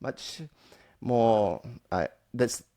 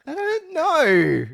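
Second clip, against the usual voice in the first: high, loud, drawn-out mock wail; hammed-up exclamation; 8 dB louder, much slower than usual. Hammed-up repeat of his point.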